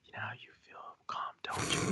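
soft fast whisper, close up; ASMR whisper. Retold or imitated. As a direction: whisper it like a creepy ASMR host. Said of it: "some dude or chick or whoever all up in my grill".

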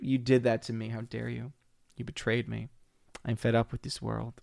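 soft, deflated mock sorrow; mock despair. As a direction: sink into fake heartbreak, quietly. Mock despair after betrayal.

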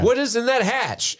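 high, wide-eyed question; mock bewilderment. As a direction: gasp at the mystery, hammed-up. Mimicking Lost mystery.

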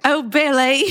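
badly faked Southern belle; botched Southern accent. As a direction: attempt a Southern accent and miss it. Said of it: "This is sounding like Billy Elliot".

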